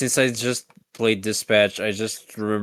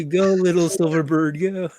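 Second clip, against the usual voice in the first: cheering the bird on; 7 semitones higher, 3 dB louder than usual. Acted. Sending the bird off.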